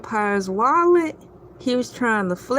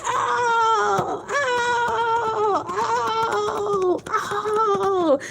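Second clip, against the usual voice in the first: high, fading wail; mock wail; 9 semitones higher, much slower than usual. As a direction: wail it up high and let it die away. High wailing sounds.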